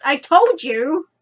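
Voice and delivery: loud, slow gloating shout. Acted. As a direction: throw it at her like a taunt. Ghost character shouts gloating.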